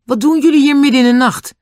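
very high, loud demand; indignant shout. A narrator's reading. Julian confronts two men outside at night, indignant shout. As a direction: shout the question at them.

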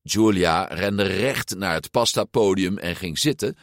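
ordinary delivery

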